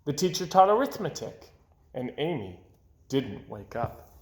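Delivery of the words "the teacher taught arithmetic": plain reading voice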